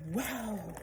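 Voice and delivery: excited wow. A narrator's reading. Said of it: "I screamed excitedly".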